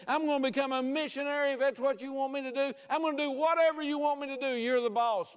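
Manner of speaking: high, insistent vow building in intensity; full-force preaching. Acted. Preacher voicing a believer's surrender to the Lord, full-force preaching. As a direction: pile up each promise, pitch climbing.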